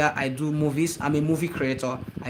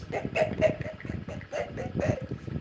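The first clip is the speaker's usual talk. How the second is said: high laugh; 8 dB quieter than usual. Real, not acted. Laughs at viewer.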